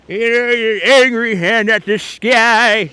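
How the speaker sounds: cartoonish angry old man, high and drawn out. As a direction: do a slow, high-pitched cranky cartoon geezer. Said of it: "my old man voice".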